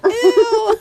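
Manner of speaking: high, loud "ew" breaking into laughter; disgusted. Retold or imitated. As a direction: recoil in comic disgust. Retelling disgust at the egg. (diring-diri na natatawa)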